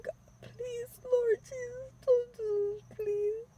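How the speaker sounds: high, crying plea to God; tearful prayer. Retold or imitated. Praying to God during the robbery, high tearful pleading. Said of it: "just praying".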